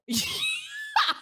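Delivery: high squealing laugh. Real, not acted. Confessing the limo beat the wedding itself, squealing laughter.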